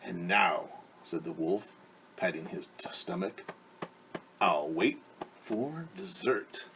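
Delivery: relishing the next meal; satisfied wolf. Acted.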